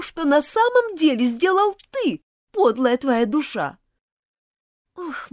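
high, loud outraged accusation; angry and contemptuous. Acted. Elizabeth accuses Martin of theft, high and furious. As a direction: hurl it at him, betrayed and furious. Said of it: "с гневом и презрением".

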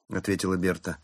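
ordinary delivery, nothing marked

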